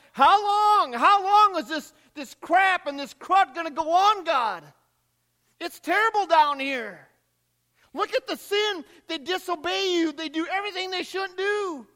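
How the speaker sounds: high, loud, desperate plea to God; pleading outcry. Acted. Martyrs crying out to God.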